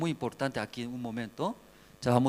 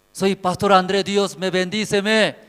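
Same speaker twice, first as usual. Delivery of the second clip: boastful petitioner to God; 6 semitones higher, 9 dB louder than usual. Acted. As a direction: call up to God, proudly presenting yourself. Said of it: "¿Así va a decir?".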